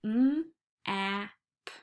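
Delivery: M, A, P said as separate stretched sounds; sounding out. Shown as a demonstration. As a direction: segment each sound slowly for kids. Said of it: "stretch the sounds using our hands".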